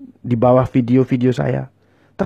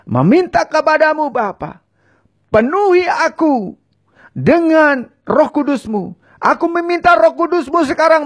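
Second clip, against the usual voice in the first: higher, louder, impassioned pleas for forgiveness; fervent pleading prayer; 12 semitones higher, 7 dB louder, slower than usual. Acted. Preacher voicing sinners' confession for viewers to repeat, fervent and pleading. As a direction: cry out each sin to God with rising urgency.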